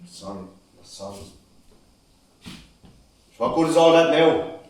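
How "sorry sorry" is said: barely audible, bitter echo of the apology; bitter murmur. Acted. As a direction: throw the word back under your breath. Son repeats father's apology bitterly.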